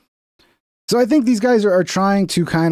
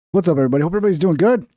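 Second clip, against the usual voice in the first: ordinary delivery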